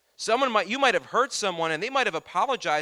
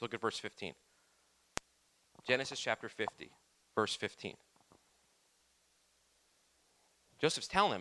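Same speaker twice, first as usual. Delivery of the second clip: soft scripture reading; 6 semitones lower, 7 dB quieter than usual. A narrator's reading.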